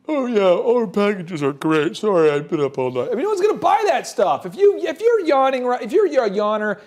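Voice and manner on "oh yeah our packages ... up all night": mock sleepy vendor. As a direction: drag it out like you were up all night.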